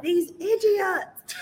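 high, very slow mock yell; drawn-out high cry of exasperation; retold scream. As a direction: draw it out, exasperated at the telly. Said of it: "screamed at the T V like".

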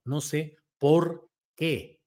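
slow, with a gap between "por" and "qué"; "por qué" as separate words. Shown as a demonstration. Host correcting the spelling of a written post, "por qué" said slowly apart. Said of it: "debe ir separado".

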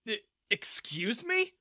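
taken aback and indignant; affronted. A narrator's reading. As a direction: rise sharply into an offended question. Glaring back at the guard.

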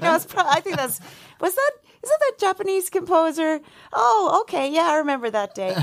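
lightly voiced high, sheepish bluff; flustered denial. Retelling his dodgy denial.

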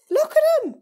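pitched well up in character; high voice. Acted. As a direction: marvel at them in a high voice. Pointing out the brainwashed followers.

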